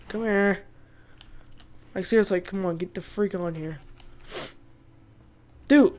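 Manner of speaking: normal talking voice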